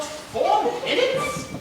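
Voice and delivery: high, slow amazed echo; stunned disbelief at the time; incredulous. Acted.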